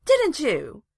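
loud, high challenge; sharp accusing question, pitched high. A narrator's reading. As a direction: demand an admission. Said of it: "she accused".

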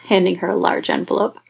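usual speaking voice

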